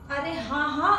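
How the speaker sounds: normal talking voice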